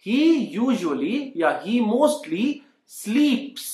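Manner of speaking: very slow, spaced out for learners; teacher saying the line for students to copy, very slow; slow model sentence. Shown as a demonstration.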